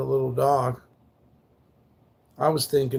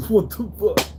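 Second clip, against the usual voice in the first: surprised outburst; 7 semitones higher, 4 dB louder than usual. Real, not acted. Surprised laugh at his own slip.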